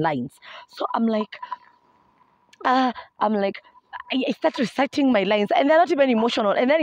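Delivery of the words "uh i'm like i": usual speaking voice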